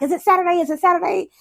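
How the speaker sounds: high, impatient repeated asking; imitated eager child. Child pesters parent about the park, high excited imitation. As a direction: pester like a child who can't wait. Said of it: "you're really looking forward to something".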